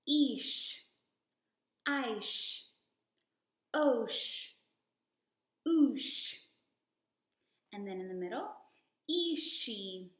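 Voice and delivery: each syllable said clearly with a strong sh and a pause; modelled syllables. Shown as a demonstration. Teacher drilling sh syllables for kids to repeat. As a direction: leave a gap for the child to repeat. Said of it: "I say, you say".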